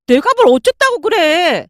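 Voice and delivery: wife snapping back at her mother; defensive retort. A narrator's reading. (발끈하는 아내)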